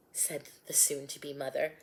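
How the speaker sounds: plain reading voice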